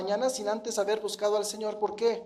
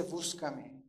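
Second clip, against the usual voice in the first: low, soft, slow appeal; hushed, low-pitched plea in God's voice; the Lord's gentle call; 7 semitones lower, 6 dB quieter, much slower than usual. Retold or imitated. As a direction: voice God's call quietly and unhurriedly. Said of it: "pero Él me dice".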